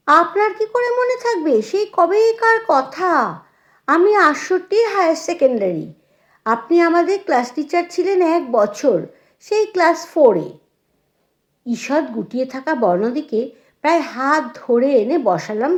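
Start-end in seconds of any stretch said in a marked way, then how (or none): 0.1-10.5 high-pitched, animated reassurance; eager reminding. A narrator's reading. Former pupil reminding her class teacher who she is, eager. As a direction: bubble over with old memories.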